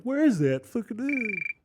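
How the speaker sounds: high-pitched befuddled self-parody; imitating stoned self. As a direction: mimic your own baffled, high self. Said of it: "my high ass was like".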